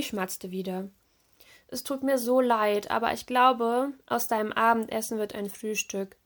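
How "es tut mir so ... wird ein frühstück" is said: ordinary delivery, nothing marked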